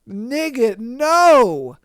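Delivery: ordinary talk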